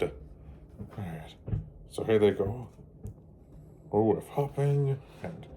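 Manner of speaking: storybook read-aloud, gentle and lively; children's storybook narration. A narrator's reading. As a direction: read it like bedtime story time for kids. Reading picture book to children.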